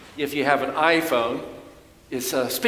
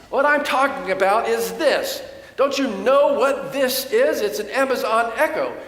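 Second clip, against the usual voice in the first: agitated son; 7 semitones higher than usual. Retold or imitated. Preacher voicing his son insisting about the Echo, mildly agitated. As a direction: explain it like it should be obvious. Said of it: "He got even more agitated".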